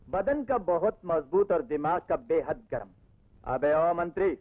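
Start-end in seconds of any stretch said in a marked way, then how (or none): 3.4-4.4 a rough cop calling out to the minister; rowdy cop. Acted. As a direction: toss it at him like a rowdy cop. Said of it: "मैं rowdy police हूँ". (मंत्री को पुकारता हुआ rowdy police)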